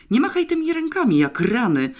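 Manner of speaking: exasperated shout; irritated outburst. A narrator's reading. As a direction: bark it out, fed up with him flailing. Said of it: "zdenerwował się".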